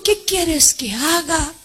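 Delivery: soft, slowed-down question. Acted. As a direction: drop the voice and ask gently. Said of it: "te está diciendo". (el Señor pregunta suave y despacio)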